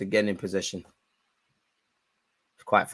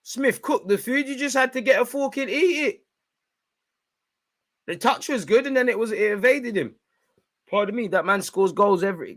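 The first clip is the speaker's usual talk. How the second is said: high exasperated rant; 11 semitones higher than usual. Real, not acted. Fan lamenting the striker's miss, high exasperated voice.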